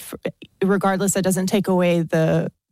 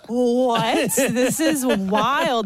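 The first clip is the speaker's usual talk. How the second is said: higher, long drawn-out disbelief; incredulous reaction; 5 semitones higher, much slower than usual. Retold or imitated. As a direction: stretch it out in amused astonishment.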